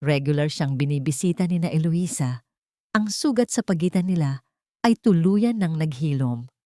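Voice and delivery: usual speaking voice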